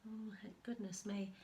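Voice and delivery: ordinary delivery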